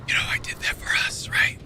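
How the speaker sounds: breathy whisper in his ear. Retold or imitated. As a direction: whisper it close and intimate. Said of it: "whispering in my ear saying".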